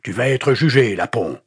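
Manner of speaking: loud high shout dropping at the end. A narrator's reading. Pastor to the captive before the crowd, shouting. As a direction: shout it as a threat. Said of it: "cria-t-il à nouveau".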